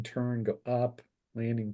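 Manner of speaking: ordinary talk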